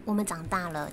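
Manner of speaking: normal talking voice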